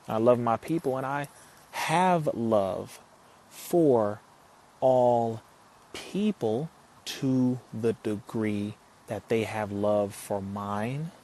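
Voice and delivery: slow and measured, pausing before "all" and stressing "all people"; deliberate emphasis. Shown as a demonstration. Streamer spelling out his love to his live viewers, slow and deliberate. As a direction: lay out "I have love for all people" word by word, stretching it slowly. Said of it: "I'll say it specifically that way".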